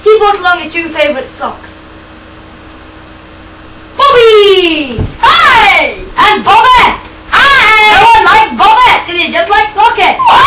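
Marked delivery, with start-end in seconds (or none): 4.0-9.0 shouted guest introductions; loud announcing. Acted. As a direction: call out each name to the crowd.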